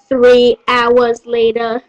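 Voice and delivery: low, slow narrator voice; time-card announcer. Acted. Narrator marking three hours later, low slow announcement.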